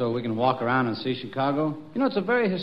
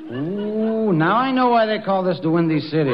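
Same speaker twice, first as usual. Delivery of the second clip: ordinary delivery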